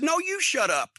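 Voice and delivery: high, sharp comeback; indignant retort. Retold or imitated. To Nikita in the locker room, a high indignant retort. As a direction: throw it right back at him, pitched high.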